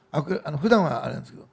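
ordinary talk

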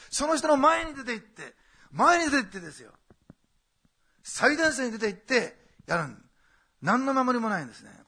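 loud, rising sermon climax, slow on the key lines; impassioned preacher pleading with the flock; preaching at full force. Acted. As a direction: drive the point home to the congregation.

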